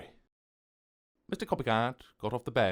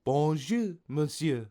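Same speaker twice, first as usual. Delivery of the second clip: higher-pitched, lilting French shopkeeper; French baker greeting; 6 semitones higher, slower than usual. A narrator's reading. Baker welcoming a customer into her shop, high French greeting.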